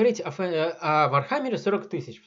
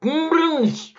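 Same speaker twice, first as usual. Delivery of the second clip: exclaiming; 11 semitones higher, 5 dB louder, much slower than usual. Real, not acted. Correct name shouted.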